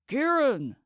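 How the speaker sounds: high, loud call across the hall. A narrator's reading. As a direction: call his name from far away. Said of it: "his men called from the far end".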